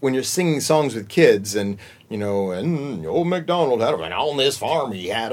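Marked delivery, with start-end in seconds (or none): 2.5-5.3 country voice. Shown as a demonstration. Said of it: "an old MacDonald kind of sound".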